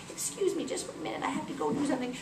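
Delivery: soft, near-whispered apology; hushed polite excuse. Acted. Isis stepping away from fisher girl, hushed politeness. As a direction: excuse yourself quietly.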